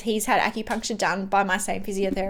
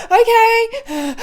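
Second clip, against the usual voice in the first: much higher, louder strained okay; a nervous patient bracing as the needle goes in; tense okay; 12 semitones higher, 7 dB louder than usual. Retold or imitated. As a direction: brace yourself for the needle. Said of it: "And I'm like".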